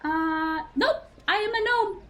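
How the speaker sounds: put-on high, matter-of-fact child voice; a little gnome boy insisting he is a gnome. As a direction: answer like an innocent kid.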